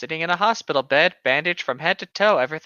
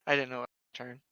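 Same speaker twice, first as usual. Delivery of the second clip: ordinary talk, nothing marked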